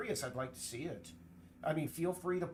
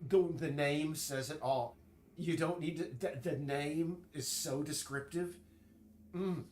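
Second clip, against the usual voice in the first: ordinary talk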